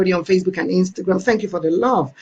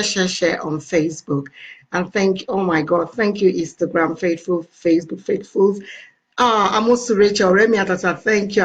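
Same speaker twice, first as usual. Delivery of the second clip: ordinary talk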